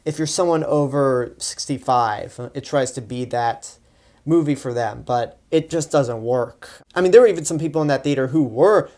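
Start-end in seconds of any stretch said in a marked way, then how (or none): none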